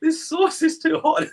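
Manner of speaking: mock high-pitched whine from the heat; complaining high. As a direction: squeak it out like your mouth is burning. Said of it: "I said".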